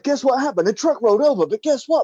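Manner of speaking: high, loud excited sportscaster read of a traffic report; frantic mock sports commentary. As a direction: call a crash like a sports play-by-play. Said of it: "they do it in the sportscaster voice".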